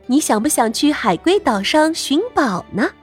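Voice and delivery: plain reading voice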